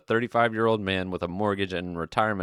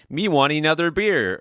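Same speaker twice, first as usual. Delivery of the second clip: put-on toddler talk; 7 semitones higher, 6 dB louder than usual. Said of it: "God forbid he join me and say".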